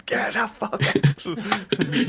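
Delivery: high, very slow mock yell; mock shout. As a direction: drag the yell out for laughs. Mock [name] throwing out guests.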